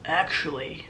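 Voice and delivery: low, soft imitation of a creepy husband; creepy man voice. The husband stares at the waitress, low and creepy. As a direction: say it low and quiet, dead serious. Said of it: "that's how he spoke".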